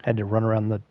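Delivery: ordinary talk, nothing marked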